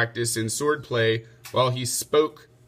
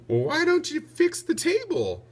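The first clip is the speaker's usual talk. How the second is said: high, light lady's voice for Lady Di; Lady Di's voice. Acted. Wife suggests a fix to her husband, high light lady's voice. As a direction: lift the voice high for the clever wife. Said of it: "suggested Lady Di".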